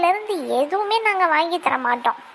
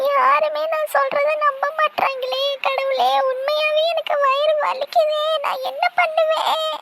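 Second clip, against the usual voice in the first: wailing; 7 semitones higher than usual. Acted. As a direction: cry out that nobody believes you. Child wails nobody believes her. (யாரும் நம்பாததால் உயர்ந்த குரலில் அழுது புலம்புதல்)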